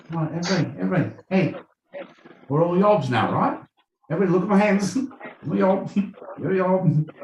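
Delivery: playful high rallying cry to the crowd; mock rally call. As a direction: rouse the crowd like a cheeky yob leader. Acted rallying of yobs.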